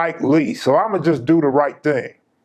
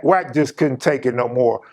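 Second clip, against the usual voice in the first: ordinary delivery, nothing marked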